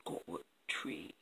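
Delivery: low, hushed magical fish; fish's whispered reply. Acted. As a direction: whisper it like an oracle from the pond. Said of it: "And the fish replies".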